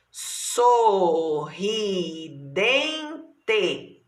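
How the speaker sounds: "Sorridente" stretched as so, rri, den, te; slow syllable by syllable. Shown as a demonstration. Teacher showing pupils how to count, very slowly syllabified. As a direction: draw out every syllable of "Sorridente". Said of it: "falando assim pausadamente".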